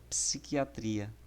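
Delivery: ordinary talk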